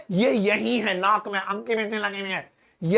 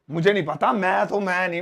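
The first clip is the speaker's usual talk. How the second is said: usual speaking voice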